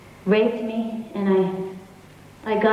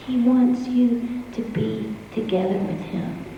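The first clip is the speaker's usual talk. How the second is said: normal talking voice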